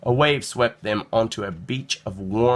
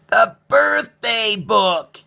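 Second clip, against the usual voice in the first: high, loud, slow eureka cry; boy suddenly understanding, loud and drawn out; excited realization; 11 semitones higher, 7 dB louder, much slower than usual. A narrator's reading. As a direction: leap up with sudden discovery. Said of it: "he said, leaping up".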